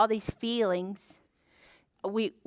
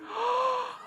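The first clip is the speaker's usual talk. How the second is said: high-pitched dramatic gasp; surprised gasp; 11 semitones higher, 5 dB quieter than usual. Pretend. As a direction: gasp as if astonished. Reacting to next egg reveal.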